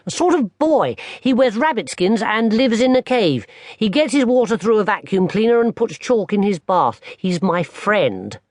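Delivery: put-on a young boy explaining; small boy. Barney tells his grandmother about his fall, high little-boy voice.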